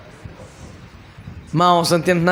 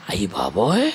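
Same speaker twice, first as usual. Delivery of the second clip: mock shock; 11 semitones lower, 5 dB quieter than usual. Disciples exclaim in mock shock.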